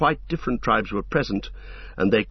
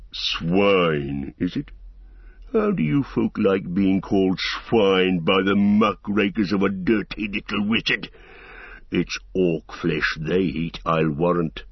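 growling; 3 semitones lower than usual. A narrator's reading. Ugluk answers insult.

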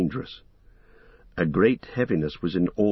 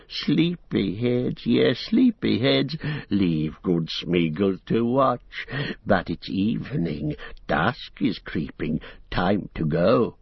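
slow, sly creature voice; coaxing Gollum; slower than usual. Acted. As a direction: Gollum coaxing the hobbits to sleep while he watches.

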